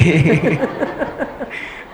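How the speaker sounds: light mock giggle; a light imitated chuckle; retold giggle. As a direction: giggle softly as the lady did. Lady's amused laugh retold.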